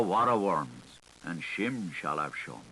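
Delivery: ordinary delivery, nothing marked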